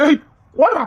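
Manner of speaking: put-on teasing yell to send the child off; playful shout. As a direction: shoo the boy off loudly. Shooing the kid away.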